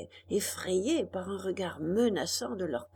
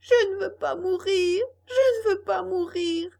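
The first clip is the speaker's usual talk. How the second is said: high, loud wail; shrill-pitched cry of a puppet fighting for life; howling plea; 9 semitones higher, 6 dB louder than usual. A narrator's reading. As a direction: howl it in desperate protest. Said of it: "qui se débattait en hurlant".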